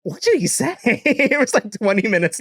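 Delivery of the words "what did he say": high-pitched questioning squeak; mock incredulous. Storyteller replaying the drive home, mock incredulous. Same retold reaction, repeated.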